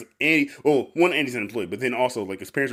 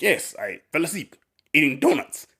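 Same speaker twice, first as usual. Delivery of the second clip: lazy boss; 5 semitones higher, 3 dB louder than usual. Retold or imitated. Coked-up boss admits napping.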